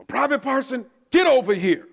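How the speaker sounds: high, loud drill sergeant shout; drill instructor bark. Retold or imitated. Drill instructor summoning the private, loud bark. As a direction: bark the name across the yard. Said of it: "drill instructors called me over".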